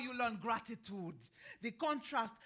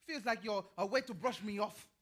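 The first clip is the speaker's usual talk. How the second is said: normal talking voice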